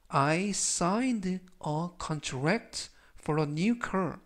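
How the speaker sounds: very slow, each word spaced out; slow read-aloud. Shown as a demonstration. Teacher slowly reading the example sentence for repetition. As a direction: drill it slowly for the class. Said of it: "천천히 세 번씩 읽어드리고요".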